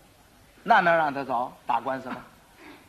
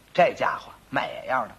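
ordinary talk, nothing marked

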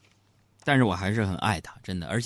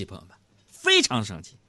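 usual speaking voice

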